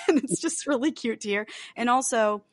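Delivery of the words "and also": normal talking voice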